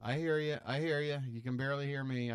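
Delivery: usual speaking voice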